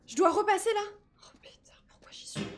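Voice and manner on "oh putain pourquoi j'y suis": scared unvoiced whisper; frightened hushed whisper. Real, not acted. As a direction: breathe it out in fear.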